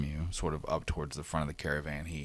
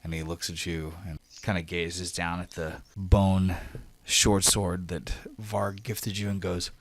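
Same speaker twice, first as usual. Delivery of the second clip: normal talking voice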